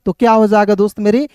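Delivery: ordinary talk, nothing marked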